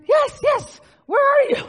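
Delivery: high, loud reply with a laugh in it; shouting back. Retold or imitated. Mother shouting back to the neighbour across the river, excited.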